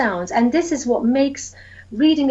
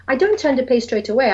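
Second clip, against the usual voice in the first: ordinary talk, nothing marked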